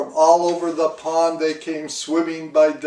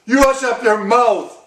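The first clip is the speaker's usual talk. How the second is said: high, loud furious order; howled rebuke; 9 semitones higher, 7 dB louder than usual. A narrator's reading. King howling down at the complaining turtle, loud and high. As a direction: howl him into silence. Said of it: "howled the mighty King Yertle".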